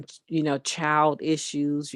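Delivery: normal talking voice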